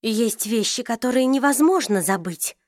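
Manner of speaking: ordinary delivery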